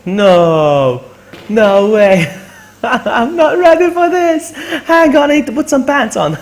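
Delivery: loud, high-pitched stunned reaction; shocked disbelief. Real, not acted. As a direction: cry out in real surprise, drawing out the words. Surprise raid hits her stream.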